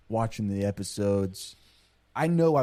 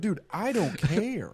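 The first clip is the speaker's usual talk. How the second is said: slow, lazy shrug of a reply; mock indifference; 9 semitones higher, slower than usual. Friend imitating Keanu's slow indifferent reply. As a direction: drag it out like you couldn't care less. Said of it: "He's like".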